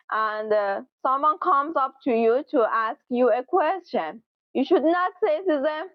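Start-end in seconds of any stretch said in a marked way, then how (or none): none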